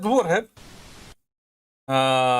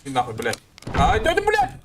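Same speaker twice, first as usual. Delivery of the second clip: panicked startled swearing; yelling curses after a jump scare; scared horror game streamer; 3 semitones higher than usual. Real, not acted.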